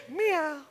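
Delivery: tiny high, soft meow; meek little cat sound; kitten meow. Acted. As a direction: mew like a harmless kitten. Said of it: "they went".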